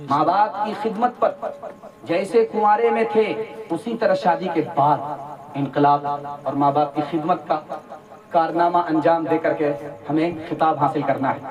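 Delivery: normal talking voice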